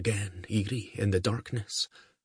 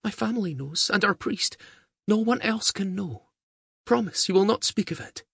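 put-on high, light girlish voice pleading; a young girl urging secrecy; young girl's voice; 13 semitones higher than usual. A narrator's reading. Young girl begs Diarmaid to keep her secret, high girlish voice. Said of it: "she whispered".

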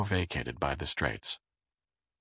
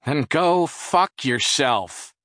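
slow, loud, higher yell; yelled back; 8 semitones higher, 8 dB louder, much slower than usual. A narrator's reading. Jerry yells back at Charles from the shower, angry. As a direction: shout it back in contempt. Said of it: "Jerry yelled back".

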